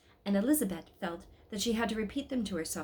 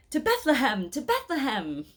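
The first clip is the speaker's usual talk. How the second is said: loud rallying call, pitched up; 6 semitones higher, 7 dB louder than usual. Acted. Shepherd rallying the pilgrims onward, loud call.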